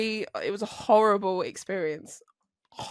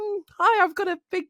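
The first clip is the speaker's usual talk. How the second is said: awkward greeting; 10 semitones higher than usual. Retold or imitated. Acts out her awkward delivery introduction.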